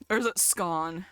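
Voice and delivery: "scone" with a short open vowel, like "skon"; questioning "skon" for "scone"; alternative pronunciation. Shown as a demonstration. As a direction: say "scone" to rhyme with gone. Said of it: "How do I pronounce that?".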